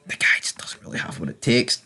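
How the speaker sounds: breathy unvoiced whisper, soft; whispered aside. Real, not acted. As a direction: let it slip out as a secret jab.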